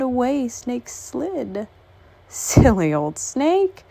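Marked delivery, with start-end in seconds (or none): none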